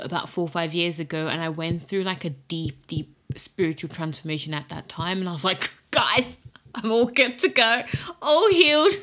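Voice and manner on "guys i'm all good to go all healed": high, loud, breezy announcement of being fine; mock overconfidence. Podcaster quoting her younger self on healing, mock overconfident. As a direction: crow it cheerfully, poking fun at your past self.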